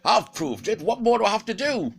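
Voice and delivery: an insulted beast protesting; indignant wolf. Acted. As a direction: Fenrir protests to Odin, high and loud. Fenrir indignant.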